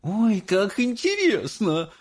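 high, drawn-out motherly excitement; high-pitched fascinated mother; exclaiming. A narrator's reading. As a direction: exclaim slowly in a high female voice. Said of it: "воскликнула миссис Боригард".

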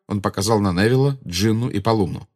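ordinary delivery

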